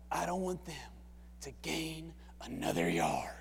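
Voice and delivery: low, intense coach voice, slowing down; coach's low voice. Retold or imitated. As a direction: pull the team close and growl it low. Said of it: "he does this thing with his voice".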